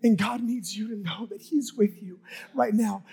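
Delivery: ordinary talk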